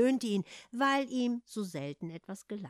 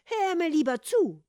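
cheeky taunt; 7 semitones higher, 8 dB louder than usual. Acted. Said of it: "beeilte sich der Kater zu sagen".

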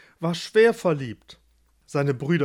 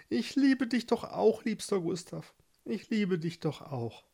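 high, then softer loving voice; tender confession; 6 semitones higher, 5 dB quieter than usual. A narrator's reading. Barbara answers Gustav's love, high and softly tender. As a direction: soften into a sweet declaration.

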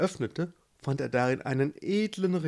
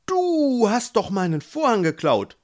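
accusing; 9 semitones higher, 6 dB louder than usual. Acted. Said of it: "beschuldigte Gerhard".

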